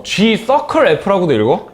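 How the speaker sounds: "G 서클 F" said slowly and clearly; name each part of "G 서클 F"; slow formal reading. Shown as a demonstration. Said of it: "그게 이제 정식 명칭이야". ("G 서클 F"를 천천히 또박또박)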